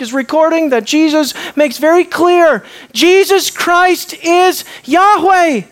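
high, forceful pulpit proclamation; impassioned preaching. Real, not acted.

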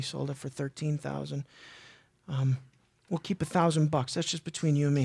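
low, hushed conspiring voice; a lightly voiced schemer, low and quiet; scheming husband. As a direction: plot quietly with your wife. Voicing Ananias scheming quietly.

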